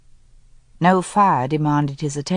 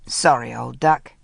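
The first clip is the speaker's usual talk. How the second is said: lower, breezy brush-off; easy Linley; 6 semitones lower than usual. Acted. Linley brushing off Helen's question, lower and easy. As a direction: toss it off lightly, a deeper man's voice. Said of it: "he said easily".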